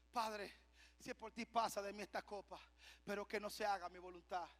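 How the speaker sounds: soft, low repeated plea; subdued prayer. Acted. Christ repeating his plea to the Father, subdued and low. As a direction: pray it quietly, sinking into surrender.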